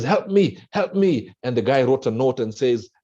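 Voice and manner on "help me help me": high, pleading call for help; desperate cry for help. Acted. Man stuck in a ditch begging a passerby, pleading high.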